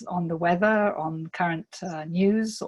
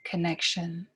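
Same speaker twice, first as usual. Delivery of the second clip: guided meditation. Real, not acted. Guide inviting listeners to breathe into themselves, calm meditation voice. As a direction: lead them gently inward, leaving space between phrases.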